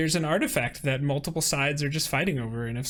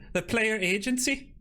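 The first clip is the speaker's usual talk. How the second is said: mock Yankee accent; 8 semitones higher than usual. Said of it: "what's that strange Yankee accent".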